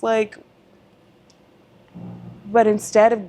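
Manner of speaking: ordinary talk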